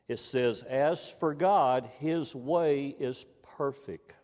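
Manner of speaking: slow, fervent reading from the pulpit; preaching scripture. Acted. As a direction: proclaim the verse to the congregation.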